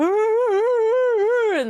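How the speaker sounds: long, high held howl; bay like a hound, one sustained note; mimicked hound baying. Showing the dog's standoff with the deer, imitated high baying. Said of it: "Me mimicking you?".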